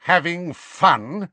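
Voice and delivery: high, slow, menacing question; enraged. A narrator's reading. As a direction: draw it out with threatening rage.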